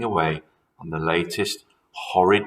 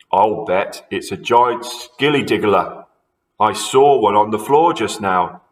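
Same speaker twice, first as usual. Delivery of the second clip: put-on louder, gleeful old husband; sly husband; 6 dB louder than usual. A narrator's reading. Husband frightening wife about skilly diggler, gleeful. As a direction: scare her on purpose.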